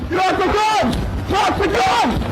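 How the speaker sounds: urgent shouting. Real, not acted. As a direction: yell it with everything you've got. Officer faces armed suspect.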